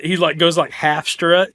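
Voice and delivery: ordinary talk, nothing marked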